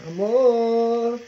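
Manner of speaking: loud, high, drawn-out call. Acted. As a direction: holler for your sweetheart, stretching the word. Calls out to partner.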